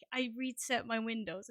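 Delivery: ordinary talk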